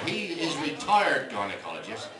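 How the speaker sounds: ordinary talk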